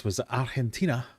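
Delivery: lightly put-on Argentinian accent on the country name; just a touch of Argentinian accent for fun; a hint of an Argentinian accent. Quiz host slips into a light Argentinian accent. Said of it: "don't do the accent, [name]".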